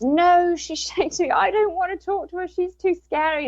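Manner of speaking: high-pitched, mildly mock protest; child's frightened refusal. Daughter refusing to talk to a scary spirit, high retold protest. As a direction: voice a child balking, pitched well up.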